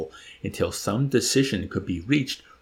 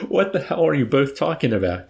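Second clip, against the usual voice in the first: higher and louder, panicky; shrill cry; 6 semitones higher, 5 dB louder than usual. A narrator's reading. Doctor Nika rounds on his men, crying out shrilly. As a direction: cry it out in rising alarm. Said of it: "cried shrilly".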